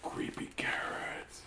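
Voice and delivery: slow whispered creepy carrots; spooky whisper. Acted. Reader whispering the creepy refrain. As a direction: breathe it out eerily.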